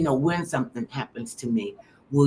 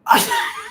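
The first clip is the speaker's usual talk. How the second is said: breathy squeal of a laugh, pitched way up; high breathless laugh; 7 dB louder than usual. Real, not acted. Recalling the funeral story, a high breathless laugh. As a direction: burst out in a high airless laugh.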